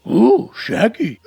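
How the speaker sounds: slow, loud cartoon talking dog. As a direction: drag it out as a goofy cartoon dog. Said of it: "when you're doing the voice of a dog".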